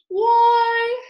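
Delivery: high, loud eager why; Dodo. A narrator's reading. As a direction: burst in to explain. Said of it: "said the Dodo".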